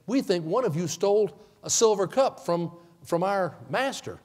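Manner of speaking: stern soldiers confronting the brothers; accusing soldiers. Acted. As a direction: call them out as the master's men.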